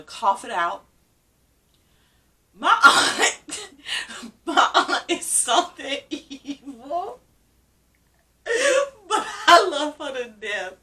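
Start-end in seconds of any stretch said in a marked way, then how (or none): 2.5-7.2 high, squealing laugh through the words. Real, not acted. Niece telling how her aunt teased her, real laughing. Laughing about aunt's teasing. 9.1-10.7 higher, louder, laughter in the voice; laughing. Real, not acted. Niece forgiving her teasing aunt, laughing.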